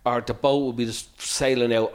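ordinary talk

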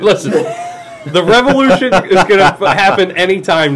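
laughing mock shout into a rally speech; mock rallying cry. Joking to his friends, mock rallying shout. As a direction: hype it up like a revolutionary, half laughing.

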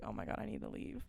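hushed, fast whisper of panic; panicked whisper. Retold or imitated. Trapped hostess whispering her own panicked thought, fast. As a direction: breathe it out like a secret escape plan.

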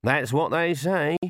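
ordinary delivery, nothing marked

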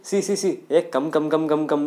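loud, rapid-fire mock challenge; dog picking a fight. Small dog challenging a German Shepherd, cocky. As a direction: goad them to come on, loudly. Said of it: "He was like".

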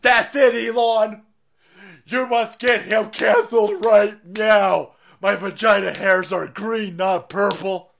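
high, loud mocking woman voice; mock Eliza Blue voice. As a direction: sneer it out in a high put-on voice. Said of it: "Somewhere Eliza Blue was like".

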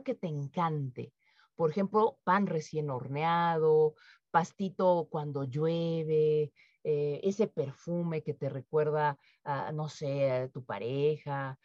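soothing workshop visualization; guided meditation. Acted. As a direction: guide them calmly into relaxation. Leads a guided breathing exercise.

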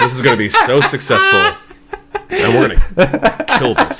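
giddy exec, laughing through; laughing gleeful. Retold or imitated. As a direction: laugh while gloating. Imagined exec gloating, laughing.

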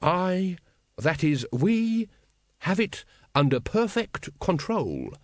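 high, measured self-assurance; smooth over every doubt, unhurried. Acted. Supreme Grand Master reassures the brethren, smoothly. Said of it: "said the Supreme Grand Master smoothly".

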